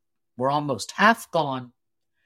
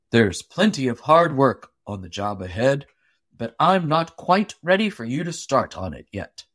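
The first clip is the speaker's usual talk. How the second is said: low, hearty and a little louder, easygoing orders; Paul the giant boss; 3 semitones lower, 4 dB louder than usual. A narrator's reading. Paul tells his axemen to take it easy, low and hearty. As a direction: boom it out kindly, keeping the secret.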